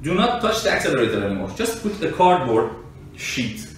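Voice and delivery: normal talking voice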